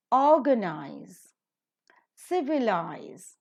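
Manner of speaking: colonize, brutalize, patronize, realize, recognize, monopolize, organize, civilize, slowly, stressed third from last; slow COL-onize, BRU-talize, mo-NOP-olize, OR-ganize, each word set apart; antepenultimate stress. Shown as a demonstration. Teacher models "-ize" words, colonize to civilize slowly, third-last syllable stressed. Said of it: "taking up their stress on the antepenultimate syllable".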